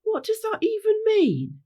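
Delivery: very high, puzzled question; baffled complaint. Acted. Pinky Bow questioning Rainbow's odd instruction, high and baffled. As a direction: query it in a high character voice.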